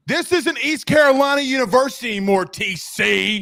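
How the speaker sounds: mock coach yelling. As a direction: ham up a rah-rah coach chewing out his player. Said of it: "I can see Todd Haley be like".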